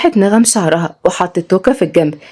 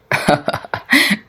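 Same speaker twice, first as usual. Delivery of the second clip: laughing man; 5 dB quieter than usual. A narrator's reading. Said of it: "ضحك سليم جدًا".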